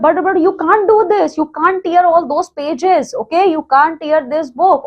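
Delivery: shocked protest. Retold or imitated. Barry protests the torn book.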